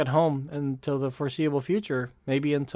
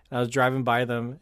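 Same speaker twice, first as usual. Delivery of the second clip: ordinary delivery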